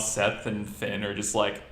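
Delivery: ordinary talk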